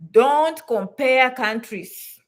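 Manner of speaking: very slow, DONT stressed; slow firm command. Real, not acted. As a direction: lay down the rule slowly. Emphatic rule.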